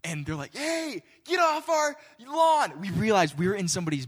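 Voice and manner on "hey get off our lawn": high, slow, strained yell at trespassers; angry homeowner shouting. Retold or imitated. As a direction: bellow it like an angry neighbor. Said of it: "we hear this shouting".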